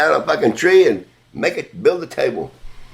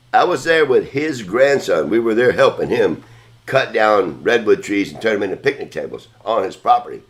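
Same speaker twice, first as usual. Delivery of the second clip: ordinary talk, nothing marked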